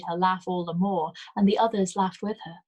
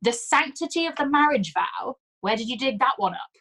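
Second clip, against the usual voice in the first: high mocking crow; The sanctity of the marriage vow crowed high and scornful; derisive crow; 6 semitones higher, 4 dB louder than usual. A narrator's reading. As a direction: repeat it back in scorn. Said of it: "a crow of derision".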